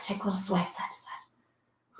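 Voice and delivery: soft breathy whisper; whispered instruction, almost no voice; whispered cue. Real, not acted. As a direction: hush it out like a calming cue. Cues a stretch softly.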